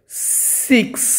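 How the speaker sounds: breathy whisper, voice off; whispered six. Shown as a demonstration. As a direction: whisper the word six as a soft unvoiced "siks". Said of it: "teacher repeating the word". (Six ଫୁସ୍ଫୁସ୍ କରି କୁହ)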